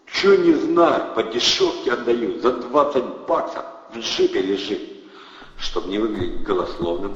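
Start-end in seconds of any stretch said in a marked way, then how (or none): 0.1-4.8 loud, high, slow-started gangster push; pushy thug. A narrator's reading. Thug brushes off Andrey's doubt, loud and pushy. Protasov pushing the sale.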